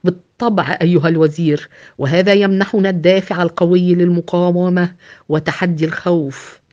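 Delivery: ordinary delivery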